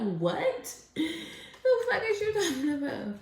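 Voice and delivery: high laughing what are you talking about; mock baffled retort. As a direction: scoff in disbelief at the accusation. Acting baffled at a jealous person.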